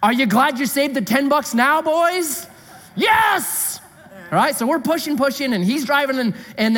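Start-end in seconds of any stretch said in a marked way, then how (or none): 0.0-2.5 mock teasing taunt; mocking wives. Wives mocking the stuck men from the car, teasing. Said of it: "mocking us". 2.9-3.8 triumph. Retold or imitated. Triumphant shout while pushing.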